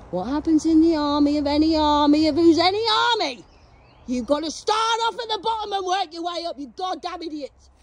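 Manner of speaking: high, loud rant at the troops; shouted tirade. Real, not acted. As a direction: holler it like an angry drill lecture. Ranting lecture to imagined army.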